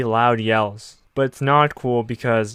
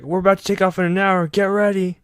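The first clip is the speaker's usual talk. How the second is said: higher, eager announcement; excited engineers; 7 semitones higher than usual. Retold or imitated. Engineers telling the crew to get ready, higher and eager. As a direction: pump everyone up to leave. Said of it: "They're like".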